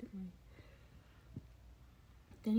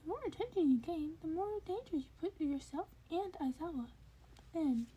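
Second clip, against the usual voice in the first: higher creature voice with a warning edge; higher-pitched warning; high warning Nezu; 8 semitones higher than usual. A narrator's reading. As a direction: caution him as the principal.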